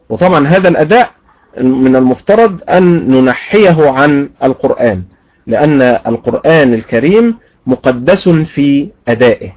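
ordinary delivery

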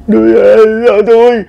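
high, loud calling of his name; anxious calling. Retold or imitated. Neighbor calling the collapsed uncle, high and loud. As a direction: call out to wake him.

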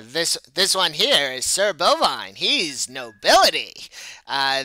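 loud promoter presenting his acts; effusive show manager. Acted. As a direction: stretch the praise words theatrically.

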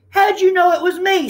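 high, startled question; surprised turkey. A narrator's reading. Turkey caught in disguise, high and startled. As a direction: caught out and amazed. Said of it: "asked Turkey".

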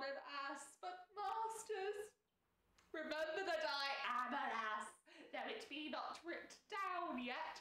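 high, drawn-out theatrical indignation; deeply hurt watchman straining to keep his dignity; Dogberry, wounded dignity. Acted. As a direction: perform a wounded, pompous Shakespearean fool, pitch swooping up. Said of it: "sense of him trying to maintain dignity, though he's been deeply hurt".

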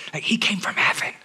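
whispered aside, a touch incredulous; stage whisper. Pretend. Pastor whispers to the congregation about Jesus, stage whisper. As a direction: keep the whisper going.

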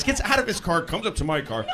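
normal talking voice

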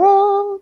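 high, drawn-out "ah"; amazed cry. Retold or imitated. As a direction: re-enact a cry of amazed relief. Acted gasp at seeing the score.

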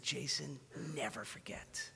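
soft whispered plea, almost breaking; tearful whisper. Retold or imitated. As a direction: breathe it out like a crying friend. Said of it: "He told me, he said".

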